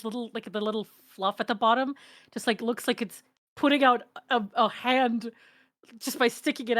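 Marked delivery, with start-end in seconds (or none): none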